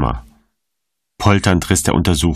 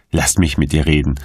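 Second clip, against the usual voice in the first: usual speaking voice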